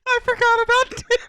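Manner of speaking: high, laughing through words. Real, not acted. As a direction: squeak it out mid-laugh. Both laughing at Timmy.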